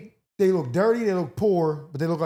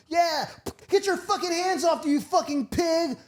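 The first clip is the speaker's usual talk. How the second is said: mock protester; 11 semitones higher than usual. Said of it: "they be like".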